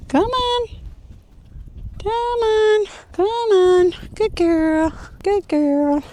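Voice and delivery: bright, high sing-song coaxing for a puppy; high puppy-calling voice. Real, not acted. Trainer calling her puppy in a high, coaxing voice. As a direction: coax the puppy along in a high, cheerful voice.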